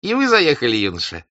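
high, smiling greeting; smiling officer. Acted. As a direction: lift the voice and smile through it. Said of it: "улыбаясь и высоко поднимая брови".

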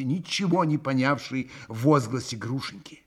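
usual speaking voice

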